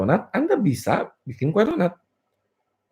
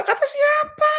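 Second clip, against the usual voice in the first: very high, squealed retort; mocking comeback; slower than usual. Coach rebutting a follower's comment on hard work, high mocking squeal. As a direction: throw it back in a silly high pitch.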